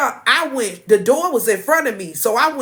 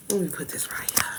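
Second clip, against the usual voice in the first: breathy aside, barely voiced; whisper; 5 semitones lower, 10 dB quieter than usual. Real, not acted. Host muttering to herself while setting something down, whispered. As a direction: whisper it to yourself while busy.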